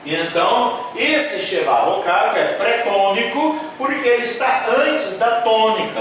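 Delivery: ordinary talk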